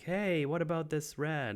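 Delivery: a hint of a curious woman; voicing Jill. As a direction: pitch up as Jill probing.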